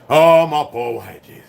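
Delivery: ordinary talk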